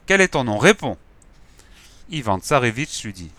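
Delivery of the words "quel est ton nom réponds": demanding answer. Acted. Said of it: "qui l'interrogea sur-le-champ".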